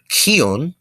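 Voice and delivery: kion with an extra H after the K; breath-pushed KH. Shown as a demonstration. Teacher introducing the word for breathe, aspirated KH. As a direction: push a puff of breath through the K in kion. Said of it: "constrict your diaphragm ... extra H sound".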